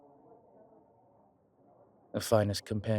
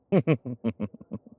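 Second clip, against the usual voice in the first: higher, loud burst of chuckling that fades; self-amused chuckle; 4 semitones higher, 6 dB louder than usual. Acted. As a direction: chuckle at your own vanity. Laughs at his own vanity.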